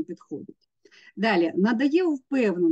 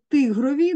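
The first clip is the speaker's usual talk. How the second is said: «тигрові» stressed on the first syllable, ТИ; наголос на перший склад; 6 semitones higher, 5 dB louder than usual. Shown as a demonstration. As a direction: lean on ТИ in «тигрові». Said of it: "наголос має бути на перший". («тигрові» з наголосом на ТИ)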